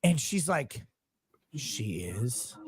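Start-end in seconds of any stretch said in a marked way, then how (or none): 1.5-2.6 low, appalled near-whisper; imitated livid disbelief. Offended girl at the club reacting to his wife, in appalled whisper. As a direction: drop to a hushed, scandalized whisper. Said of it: "she's livid".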